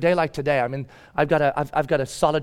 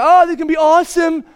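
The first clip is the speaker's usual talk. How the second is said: high, loud gushing; mock-eager, pitched way up; naive excitement; 10 semitones higher, 7 dB louder than usual. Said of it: "You wanna go".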